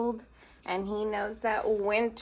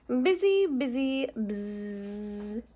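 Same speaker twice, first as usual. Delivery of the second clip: buzzing bee voice; slower than usual. Acted. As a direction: buzz like a busy bee. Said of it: "says the bees".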